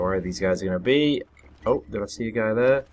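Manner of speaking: usual speaking voice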